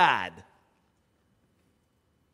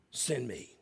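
much softer, lower offering; hushed surrender; 8 semitones lower, 13 dB quieter than usual. Retold or imitated. Isaiah answering God, hushed and humble. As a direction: give yourself up quietly.

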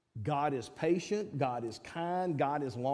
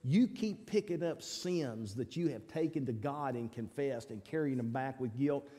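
normal talking voice

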